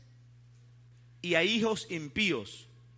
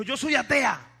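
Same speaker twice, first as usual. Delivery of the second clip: raised, louder mock outburst of a hostile atheist; aggressive; 4 semitones higher, 5 dB louder than usual. Atheist woman declaring herself to the evangelists, acted aggressively. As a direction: imitate an atheist bluntly rebuffing evangelists. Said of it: "Se puso agresiva".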